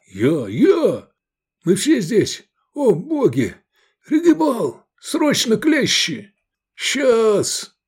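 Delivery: high, strained rescue shouts; urgent calling. A narrator's reading. Rescuers summon a smith with pliers, urgent cries.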